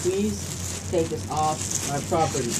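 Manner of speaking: slow, low, soft plea; quiet, drawn-out exasperated request; weary pleading. Real, not acted. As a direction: plead slowly, patience running out. Pleads with friend to remove powder.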